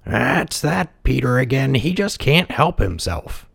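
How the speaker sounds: high, slow character voice; grumbling villager. A narrator's reading. Another villager grumbles about the boy.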